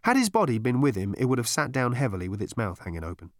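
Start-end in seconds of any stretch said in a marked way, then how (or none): none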